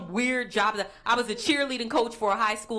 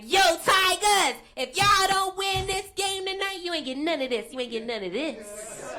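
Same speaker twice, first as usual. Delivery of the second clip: taunting cheer; 8 semitones higher than usual. Retold or imitated. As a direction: chant a sassy warning at the players. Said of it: "They was like".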